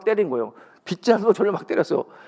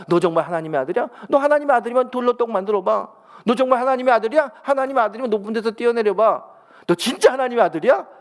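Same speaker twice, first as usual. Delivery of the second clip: higher, louder mocking tempter; taunting Satan; 6 semitones higher, 3 dB louder than usual. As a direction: push the doubt again and again. Satan's repeated taunts acted out. (예수님을 시험하며 조롱하는 사탄, 높고 크게)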